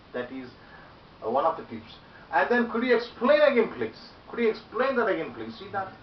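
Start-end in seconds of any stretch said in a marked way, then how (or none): 2.7-5.5 high, loud lift on "explain", falling away to "please"; animated, loud plea to explain; expressive polite request. Shown as a demonstration. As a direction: punch "explain" with life, drop on "please". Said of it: "work it out with your mouth, the expression".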